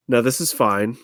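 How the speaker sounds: normal talking voice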